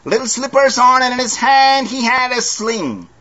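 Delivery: high, ringing preacher's voice; fervent pulpit delivery; preaching. Acted. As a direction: lift it up to the congregation. Preaching burst in a sermon.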